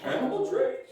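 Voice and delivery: high squeaky voice, bewildered by the trees; confused sidekick. Retold or imitated. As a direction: pitch way up as a baffled cartoon sidekick. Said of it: "And the other guy's like".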